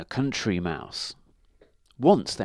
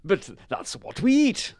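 high, loud storybook cow; protesting cow; 10 semitones higher, 5 dB louder than usual. A narrator's reading. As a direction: answer back, pitched well up. Cow answers the complaining dog.

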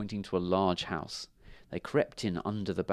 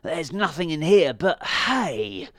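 slow, raised grumble; mildly growling, disgusted dog; growled complaint; 7 semitones higher, 5 dB louder, slower than usual. A narrator's reading. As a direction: draw out the complaint, louder and higher. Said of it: "he growled".

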